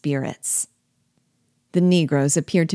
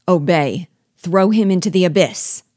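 louder, rising fierce order; forceful raised command; commanding shout; 3 semitones higher, 6 dB louder than usual. A narrator's reading. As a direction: demand instant obedience. Dwarf orders an execution.

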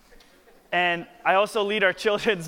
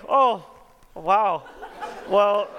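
higher, louder astonished oh wow with a laugh; stunned surprise; 5 semitones higher, 6 dB louder, much slower than usual. Retold or imitated. Young pastor stunned by the old woman's answer, laughing surprise. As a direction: gasp it out, floored. Hears Satan excuse.

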